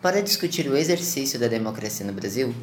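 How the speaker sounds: ordinary talk, nothing marked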